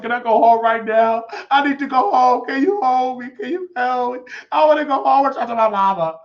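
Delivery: high, loud hammed-up wailing; mock tearful pleading. Fallen recruit begging to go home, mock wailing. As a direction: beg like a homesick child in tears.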